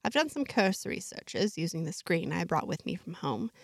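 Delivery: plain reading voice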